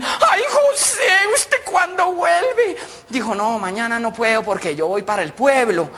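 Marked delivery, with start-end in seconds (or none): 0.0-2.7 high, drawn-out coo; delighted woman. Acted. Paola wants José to return, delighted. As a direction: coo it in a comic falsetto.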